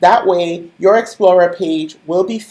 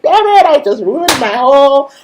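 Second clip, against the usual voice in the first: exasperated groan; 6 semitones higher, 5 dB louder than usual. Retold or imitated. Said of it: "I was like".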